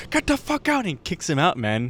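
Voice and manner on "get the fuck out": very high mock-angry order; angry shop worker. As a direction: imitate a worker kicking someone out. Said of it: "he's like".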